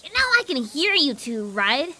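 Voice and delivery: deadpan snark. Acted. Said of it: "with a deadpan snark".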